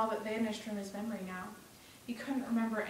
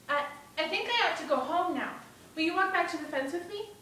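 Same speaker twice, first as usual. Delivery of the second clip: put-on higher-pitched, hesitant boy; uneasy young Bruno; 6 semitones higher than usual. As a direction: ask like a boy who wants to go home.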